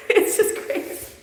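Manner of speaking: voiceless words squeezed out through laughter; helpless laughter. Real, not acted. Host cracking up over a crazy performance, helplessly laughing. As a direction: barely get it out for laughing.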